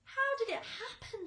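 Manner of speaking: high alarmed question; crying out. A narrator's reading. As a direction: cry out in disbelief. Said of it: "they cried".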